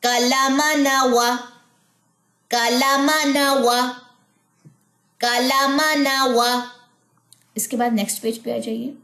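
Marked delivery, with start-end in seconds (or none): 0.0-6.7 slow, sing-song syllables; rhythmic reading. Shown as a demonstration. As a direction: read it in one steady rhythm. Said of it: "sounds पढ़ते हैं एक साथ".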